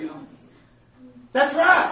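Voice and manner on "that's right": high, loud preacher's amen; shouting agreement to the congregation; loud affirmation. Real, not acted. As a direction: proclaim it with fire. Affirming the word loudly.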